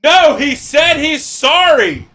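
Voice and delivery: imitating a cashier shouting the apology to the kitchen; mock shout to the kitchen. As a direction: yell it back to the crew as a parody. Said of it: "and then you hear".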